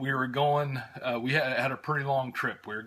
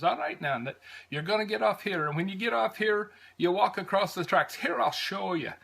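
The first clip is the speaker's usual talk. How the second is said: higher-pitched imitation of a helpful Irish conductor's brogue; 7 semitones higher than usual. Conductor showing Americans where to change trains, Irish brogue. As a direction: guide the tourists kindly in an Irish accent. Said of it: "lovely brogue that I have".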